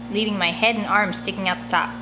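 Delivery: ordinary delivery, nothing marked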